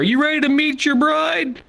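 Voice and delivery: high teasing sing-song voice; mock sing-song tease. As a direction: needle him like a giddy friend. Friend teasing through door.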